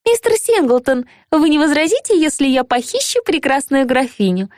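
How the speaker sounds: sweet and high, with a smile; cartoon princess. A narrator's reading. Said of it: "С очаровательной улыбкой обратилась она".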